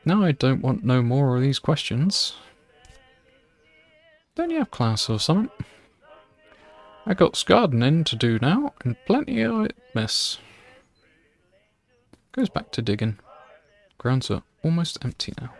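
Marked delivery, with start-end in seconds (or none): 0.1-10.4 a hint of a grumpy country gardener shooing her away; gardener's rural accent. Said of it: "Do that accent?".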